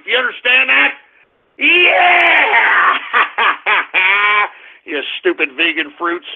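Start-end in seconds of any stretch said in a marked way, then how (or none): 1.6-3.0 high, drawn-out triumphant yell; gloating shout. Pretend. Host gloats to vegans about beef prices, gloating shout. 3.0-4.5 rapid hammed-up ha-ha-ha; gloating laugh. Host mocks vegans over beef prices, gloating laugh.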